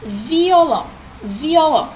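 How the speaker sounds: violão said slowly, vio and lão clearly apart; careful violão. Shown as a demonstration. Teacher demonstrating to pupils, careful violão. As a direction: spell violão out by its sounds. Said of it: "Olha como nós falamos a palavra violão".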